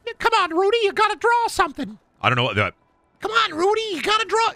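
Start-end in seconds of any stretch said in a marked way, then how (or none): 0.1-2.0 very high squeaky chant; mock the chat in a tiny cartoon squeak. Teasing the chat back in a squeaky voice. 3.2-4.5 very high squeaky chant; mock the chat in a tiny cartoon squeak. Teasing the chat back in a squeaky voice.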